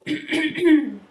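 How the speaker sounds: sudden loud rasping throat clear. Real, not acted. Reader losing their voice mid-reading, loud throat clear. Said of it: "Sorry about that".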